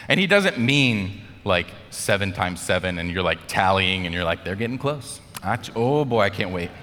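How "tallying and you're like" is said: usual speaking voice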